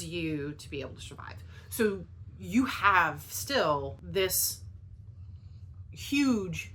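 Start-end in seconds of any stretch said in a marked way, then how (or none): 0.0-1.4 whispered. Real, not acted. Emphatic point about survival.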